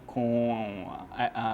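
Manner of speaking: ordinary delivery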